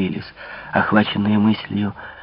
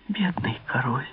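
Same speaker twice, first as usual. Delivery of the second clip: soft pitying whisper; 4 semitones higher, 9 dB quieter than usual. A narrator's reading. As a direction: breathe it out in a hushed voice. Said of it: "прошептала принцесса". (шёпотом)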